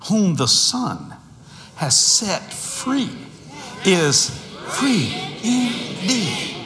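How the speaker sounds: drawn-out preaching of the verse; slow proclamation rising to a shout on the last words; slow scripture quote. Acted. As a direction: stretch each word like a sermon climax. Quoting scripture slowly.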